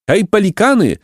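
high, carrying hail; mildly projected, high-pitched call; resounding call. A narrator's reading. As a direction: call out high as if across water. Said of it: "zawołał donośnym głosem".

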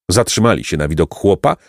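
usual speaking voice

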